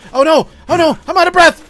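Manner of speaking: loud, high-pitched gamer panic; panicked shout. Real, not acted. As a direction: yell it as you flee in a panic. Panicking while fleeing game wolf.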